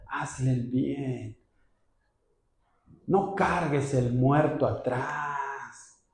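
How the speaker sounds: soft, slow, tearful pleading; tearful tender plea. Acted. Holy Spirit gently urging the child to forgive, tearful. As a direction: speak as a loving Spirit, near tears.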